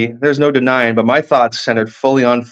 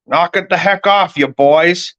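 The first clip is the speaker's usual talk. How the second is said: higher-pitched irritated scolding; angry bus driver; 6 semitones higher, slower than usual. A narrator's reading. Bus driver scolding two boys, angry and fed up. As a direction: tell off rowdy kids from the driver's seat. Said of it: "the angry eyes of the bus driver".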